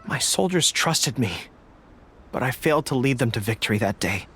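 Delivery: low, somber remembrance. Acted. General tells the traveler of her fallen soldiers, low and somber.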